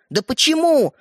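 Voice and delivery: high, exasperated question; exasperated demand. Acted. Brother demanding the toy from Стёпа, exasperated. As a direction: push for a reason, pitch leaping up.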